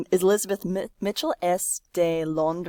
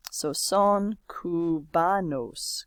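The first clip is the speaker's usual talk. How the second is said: slow model reading; much slower than usual. Shown as a demonstration. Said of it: "Cubano for one person".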